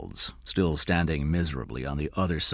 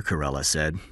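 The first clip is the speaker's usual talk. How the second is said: ordinary delivery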